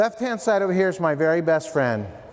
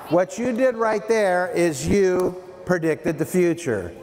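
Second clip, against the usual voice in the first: usual speaking voice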